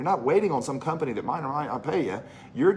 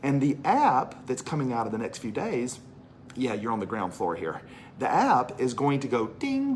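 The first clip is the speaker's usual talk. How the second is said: ordinary talk, nothing marked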